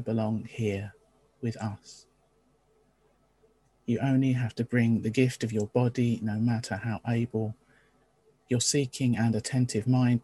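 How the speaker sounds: calm, measured liturgical reading; opening words reading. Acted. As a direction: read it as a gentle call to worship. Reads the opening words of worship.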